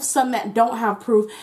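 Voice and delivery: usual speaking voice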